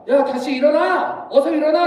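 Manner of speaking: stretched, annoyed call to get up; mother calling out. Acted. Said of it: "이제 한 번 더 외칩니다".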